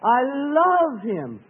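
drawn-out, high-pitched devotion; heartfelt love. Shown as a demonstration. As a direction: release your heart on a long, high LOVE. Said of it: "release your heart in love to God".

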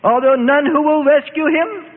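high, slow, shrill-voiced old mother's cry; voiced aged mother crying out for rescue; old woman screaming. A narrator's reading. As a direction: wail it as a desperate old woman. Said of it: "her aged mother screamed".

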